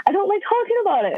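high-pitched, retold tearful protest; upset. Daughter in the car with mom asking what's wrong, mock upset. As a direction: mimic being upset, voice pitched right up. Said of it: "And I started crying".

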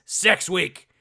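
loud, high mock hype; hammed-up excited announcement; mock scandalized excitement. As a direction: proclaim it like lurid news. Mocking how old listeners should hear it.

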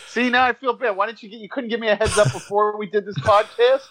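high-pitched, comic guilty complaint; embarrassed protest. Real, not acted. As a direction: plead with your co-hosts for not warning you. Embarrassed after learning wrestler died.

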